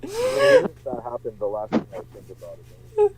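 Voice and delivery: muffled, as if into a pillow. Real, not acted. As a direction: talk with a pillow over your face. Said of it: "are you whispering into your pillow".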